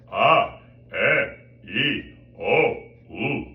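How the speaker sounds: loud, buzzing vowel series; separate, carrying vowels, resonance demo; resonant vowels. Shown as a demonstration. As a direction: project each vowel with a ringing buzz. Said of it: "buzzing quality that you can hear".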